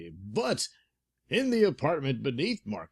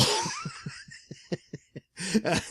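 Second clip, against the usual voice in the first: villain laugh. Acted. Markov laughs at thug.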